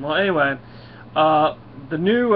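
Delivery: ordinary delivery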